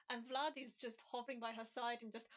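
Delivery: ordinary delivery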